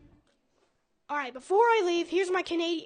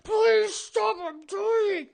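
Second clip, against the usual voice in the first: pleading; 5 semitones higher, 4 dB louder, much slower than usual. Acted. Voicing the overfed elevator.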